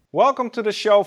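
ordinary talk, nothing marked

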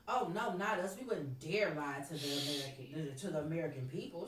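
mock the liars' pious denial; sarcastic officials claiming innocence; mock innocent denial. Mocking lying officials.

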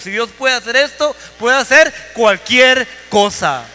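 loud, rising sermon climax, slowing on key lines; preaching at full force. Acted. Preacher to his church, urging that God can raise the dead, full-force preaching. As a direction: preach it to the back row, building and booming. Closing sermon appeal to congregation.

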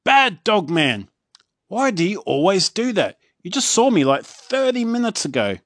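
high, loud scolding; scolding chief. A narrator's reading. Chief scolding Dog Man, high and loud. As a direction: scold the dog like a fed-up boss.

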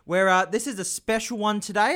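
big showman welcome, loud and high; announcer voice. Pretend. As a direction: boom it out like a game show host. Host's announcer-style opening.

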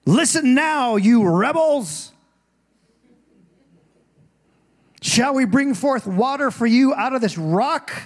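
loud, high scolding of rebels; furious leader rebuking the people; angry Moses. Retold or imitated. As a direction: berate the grumbling crowd. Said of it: "He's upset. He's angry".

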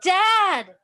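high loud cry, voice cracking; voice breaking. A narrator's reading. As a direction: call out until the voice breaks. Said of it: "His voice broke on the last one".

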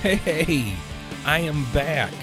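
ordinary talk, nothing marked

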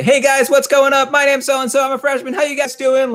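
confident host greeting a crowd; hyped-up speaker. Retold or imitated. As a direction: bring big hype to the crowd. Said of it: "they bring the energy".

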